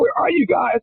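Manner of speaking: high, hammed-up shout of a teammate; mock shout. Mo Vaughn calls to ask where they are, mock shout. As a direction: yell it like a friend calling out, half laughing. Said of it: "He's like".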